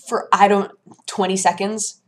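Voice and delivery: normal talking voice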